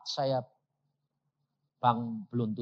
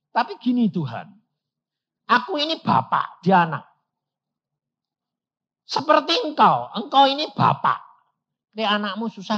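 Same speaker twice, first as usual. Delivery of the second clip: raised, high, urgent pleading to God; loud pleading prayer; 7 semitones higher, 10 dB louder than usual. Retold or imitated. Preacher retelling his prayer to God, loud and pleading. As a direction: argue with God like a son to his father.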